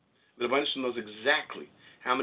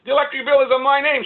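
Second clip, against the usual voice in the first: high, loud panicked yell; retold panic at full volume; 14 semitones higher, 13 dB louder than usual. Young husband panicking to his wife over a bill, high frantic shout. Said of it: "Why are you panicking?".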